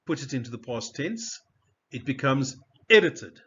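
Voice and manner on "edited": EDITED with loud ED and light endings; first-syllable stress. Shown as a demonstration. Teacher gives the past tense, EDITED punched on ED. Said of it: "it's not a stressed syllable".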